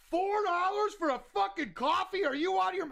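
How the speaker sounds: high indignant rant; mock outrage. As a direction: bark it like a cheapskate. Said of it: "He's like".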